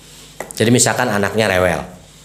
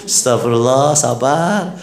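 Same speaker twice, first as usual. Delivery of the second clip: patient wife. Retold or imitated. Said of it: "Diomongin sama tetangga".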